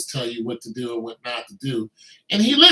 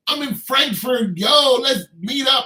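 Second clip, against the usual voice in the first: excited reunion call; 8 semitones higher, 6 dB louder than usual. Retold or imitated. Acted reunion dialogue in Germany.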